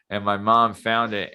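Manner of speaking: ordinary delivery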